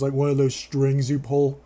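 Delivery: normal talking voice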